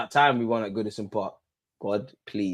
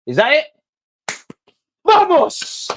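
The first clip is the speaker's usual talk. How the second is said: very high, loud yell of triumph; victory shout; 8 dB louder, slower than usual. Real, not acted. Fan celebrates the final whistle with a very high, loud cry. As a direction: roar it like you just won.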